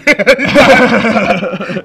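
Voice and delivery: loud high cackle; loud burst of laughter. Real, not acted. Big laugh at the board.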